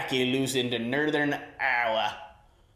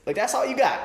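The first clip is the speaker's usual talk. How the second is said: taunting; 10 semitones higher, 3 dB louder, faster than usual. Real, not acted. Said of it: "Who celebrates like that?".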